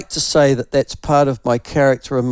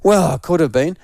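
ordinary talk

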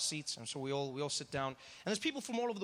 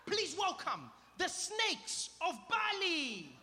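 big master-of-ceremonies build; show announcer; 9 semitones higher than usual. Retold or imitated. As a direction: present the act with grand, stretched words. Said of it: "he looks at us and he's like".

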